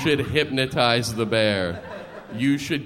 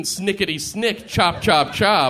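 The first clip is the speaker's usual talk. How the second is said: claw sound effects; 6 semitones higher, 3 dB louder than usual. Pretend. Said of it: "if you're Wolverine".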